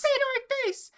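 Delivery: very high, loud mock screech; screaming kid imitation. Echoing the crying kid's challenge, high screamed imitation. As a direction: shriek it like a tantrum.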